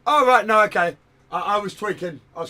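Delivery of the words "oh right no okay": high, loud startled outburst; sudden loud realisation. Real, not acted. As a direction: blurt it as it dawns on you.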